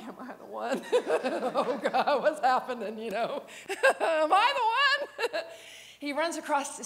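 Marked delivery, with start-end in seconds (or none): 0.0-5.4 laughing disbelief. Real, not acted. Laughing at the question.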